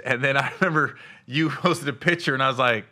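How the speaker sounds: usual speaking voice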